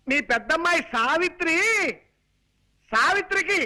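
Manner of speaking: high, emphatic announcement for a deaf listener. Acted. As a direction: shout the good news phrase by phrase. Calling news out to deaf mother-in-law.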